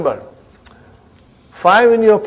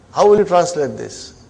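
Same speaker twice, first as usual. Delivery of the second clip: ordinary talk, nothing marked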